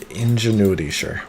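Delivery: ordinary delivery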